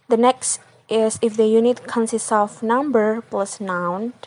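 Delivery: usual speaking voice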